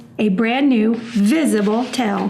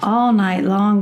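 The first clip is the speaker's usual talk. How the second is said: ordinary delivery, nothing marked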